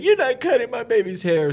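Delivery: high-pitched, mock-weepy protest; tearful wife imitation. Husband imitating wife refusing a haircut, tearful protest. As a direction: imitate a mother protesting near tears. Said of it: "literally tearing up".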